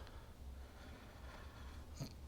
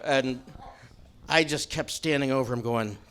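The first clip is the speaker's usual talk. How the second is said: usual speaking voice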